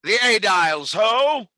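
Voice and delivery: loud summons to the guards; calling out. Acted. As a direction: call the guards across the hall. Tribune summons the officers.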